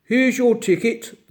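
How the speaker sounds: a lightly voiced gruff bear gatekeeper. Bear at the gate welcoming the children, lightly gruff. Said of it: "the gruff voice said".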